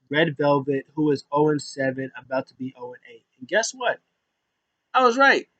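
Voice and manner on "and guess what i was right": high-pitched gloating reveal; triumphant. Real, not acted. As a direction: crow about being proven right. Boasts that the prediction came true.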